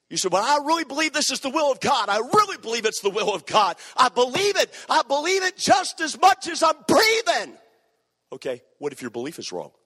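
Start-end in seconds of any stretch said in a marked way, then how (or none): 0.3-7.6 rising, louder insistence; mock stubborn insistence building to a shout; insistent believer. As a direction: press harder with each line.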